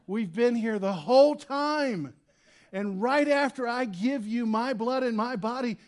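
preacher voicing an exasperated Jesus at his disciples. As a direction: throw up your hands at these twelve. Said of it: "if I'm Jesus".

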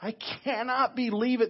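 scandalized, stretching the words high; outraged rebuke. Acted. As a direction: let the built-up steam out in indignation. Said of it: "building steam and building steam".

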